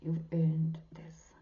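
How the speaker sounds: slow, hushed praise; slow sincere whisper. Real, not acted. Assuring Sagittarians they earned it, slow whisper. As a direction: press it in gently, slowly.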